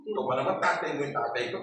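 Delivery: ordinary talk, nothing marked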